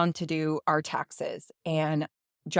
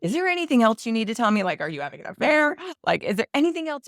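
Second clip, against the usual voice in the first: high, rapid-fire upset demands; retold exasperated questioning, pitched up; 5 semitones higher, 3 dB louder, faster than usual. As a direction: imitate a distressed husband's rushed interrogation. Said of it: "he was so upset with me".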